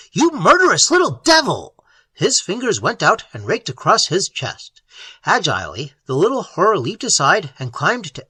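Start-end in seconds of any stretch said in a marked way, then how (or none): 0.1-1.8 high, loud furious scream. Acted. Elliot screaming at the creature on his chest, loud and high. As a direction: hurl the insult. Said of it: "Elliot screamed".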